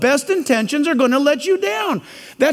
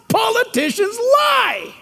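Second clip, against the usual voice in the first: higher, slow, shouted punchline; emphatic declaration; 9 semitones higher, slower than usual. Real, not acted. Preacher warns young voters, slow and much higher. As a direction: hammer the point home slowly. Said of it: "emphatic point".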